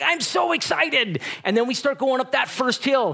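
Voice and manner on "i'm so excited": put-on high, slow, gleeful child voice; a boy thrilled before the ride; excited kid. Son in the roller coaster line, excited kid voice. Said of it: "He's like".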